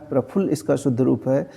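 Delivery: usual speaking voice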